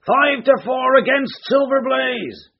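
high, slow roaring call of odds; roaring bookies. A narrator's reading. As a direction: bellow the odds across the ring. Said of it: "roared the ring".

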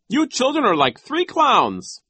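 very high, loud, slow character voice; mocking Nero. A narrator's reading. Nero calls the children clowns, high mocking voice. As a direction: jeer it high and slowly.

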